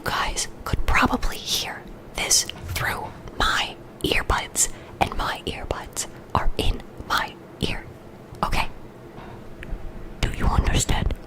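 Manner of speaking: soft close whisper; ASMR whisper. Real, not acted. Streamer whispering through earbud trouble to chat.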